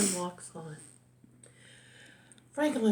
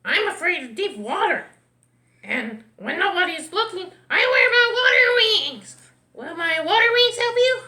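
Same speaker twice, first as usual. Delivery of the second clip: high, loud cartoon duck; excitable duck, pitched way up; 9 semitones higher, 8 dB louder than usual. A narrator's reading. Duck explains its fear and remedy.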